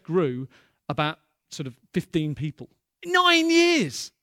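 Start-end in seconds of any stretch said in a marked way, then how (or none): 3.0-4.1 high, loud outburst of disbelief; nine years, flung out high and loud; exclaimed. Real, not acted. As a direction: stress the long wait with a loud cry. Preacher exclaiming the long wait.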